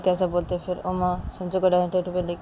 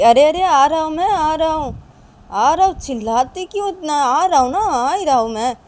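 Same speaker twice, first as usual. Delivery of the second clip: high, loud irritated father calling back; annoyed shout; 8 semitones higher, 8 dB louder than usual. Acted. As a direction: shout back from the other room. पापा चिल्लाकर जवाब देते. (चिढ़े हुए पापा की ऊँची पुकार)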